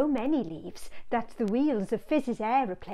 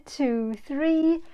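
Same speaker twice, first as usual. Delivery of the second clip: plain reading voice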